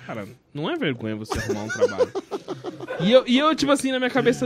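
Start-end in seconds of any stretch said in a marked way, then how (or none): 0.1-2.1 fast, lower imitating of a lecturing friend; lightly imitated stern advice; mock advice. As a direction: deliver it briskly, deadpan. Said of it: "ele falou".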